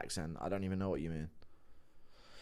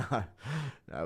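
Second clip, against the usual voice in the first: ordinary talk, nothing marked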